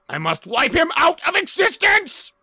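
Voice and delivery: high, loud villain bellow; villain's furious shout. Acted. Villain vowing to destroy Stardust, roaring high. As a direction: rant it like a comic-book supervillain.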